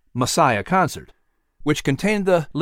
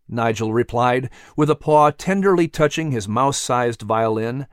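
ordinary talk

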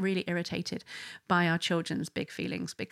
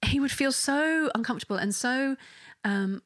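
normal talking voice

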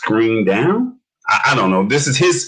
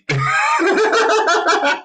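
high-pitched laugh. Real, not acted. Host bursts out laughing at failure.

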